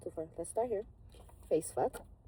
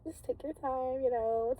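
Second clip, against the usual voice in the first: mock pleading girl; 8 semitones higher, 6 dB louder than usual. Voicing the girls at the partner.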